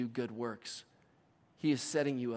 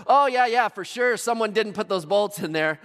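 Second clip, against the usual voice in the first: high, loud careless mechanic voice; mock-cheerful mechanic, loud and high; breezy mechanic; 8 semitones higher, 10 dB louder than usual. Jiffy Lube worker answering.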